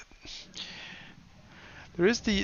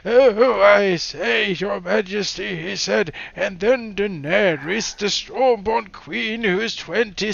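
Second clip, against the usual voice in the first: put-on higher, louder doddering old narrator; crappy old man voice; 7 semitones higher, 3 dB louder, slower than usual. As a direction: ham up a creaky old reader. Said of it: "same crappy old man voice".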